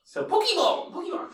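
Pokeball said high with an ee sound in the middle; demonstrating pronunciation. Shown as a demonstration. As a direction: say Pokeball with ee, then Pokemon. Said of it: "I say Pokemon and I say Pokeball".

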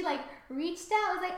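normal talking voice

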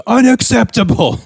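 high-pitched imitation of an outraged yell; mock yell. Imitating dad yelling at his son, mock yell. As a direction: cry it out like a fed-up TV dad. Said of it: "he actually yells this word".

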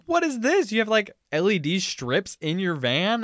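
high-pitched amazed question; shocked discovery, loud and high; surprised. Retold or imitated. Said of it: "was just like".

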